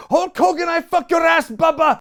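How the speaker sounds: very high, loud shouted impression; imitating a strained, high-pitched yell; Iron Sheik rant. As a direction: burst back into a furious wrestler's tirade. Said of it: "goes back to Sheik".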